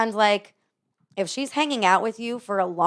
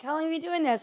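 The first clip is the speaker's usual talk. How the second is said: higher, softer retold question; pitched-up quoted question; mimicked question; 8 semitones higher, 5 dB quieter, faster than usual. Retelling a customer's question to herself.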